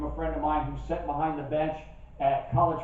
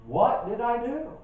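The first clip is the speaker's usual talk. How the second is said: higher, slow wounded question; hurt spouse; 7 semitones higher than usual. Retold or imitated. Spouse wondering why she was yelled at, higher. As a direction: sound baffled and wronged. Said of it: "my spouse was wondering".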